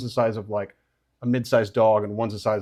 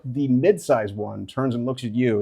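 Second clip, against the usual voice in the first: ordinary talk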